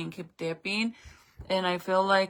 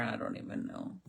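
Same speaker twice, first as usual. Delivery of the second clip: normal talking voice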